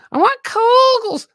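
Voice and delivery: very high, slow whiny plea for Kogels; whiny wife voice. Retold or imitated. Retelling his wife asking for Kogels in a high whine. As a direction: squeal it like a spoiled wife. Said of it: "that's all I heard in Texas".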